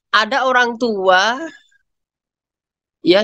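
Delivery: ordinary delivery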